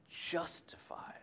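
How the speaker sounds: soft hushed emphasis; whispered. Acted. Preacher whispering the key word to the congregation. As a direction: breathe the word justified.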